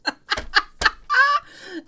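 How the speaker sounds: a burst of high laughter. Real, not acted. Reader cracking up at the poo-poo head joke, laughing. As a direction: laugh out loud, high and bright.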